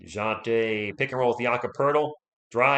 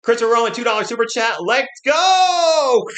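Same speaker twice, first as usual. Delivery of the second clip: excited shout; 9 semitones higher, 7 dB louder than usual. Real, not acted. Hyped thanks for super chat.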